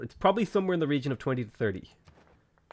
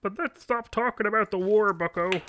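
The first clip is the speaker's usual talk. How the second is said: put-on higher, scolding Canadian accent; Jordan Peterson impression; 8 semitones higher than usual. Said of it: "Jordan Peterson Canadian accent".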